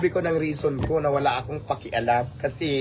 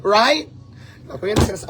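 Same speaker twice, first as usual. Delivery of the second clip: emphatic challenge; 7 semitones higher than usual. Real, not acted. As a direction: throw the question back hard. Challenging bashers.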